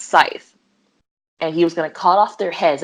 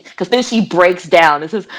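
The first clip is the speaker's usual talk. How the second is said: usual speaking voice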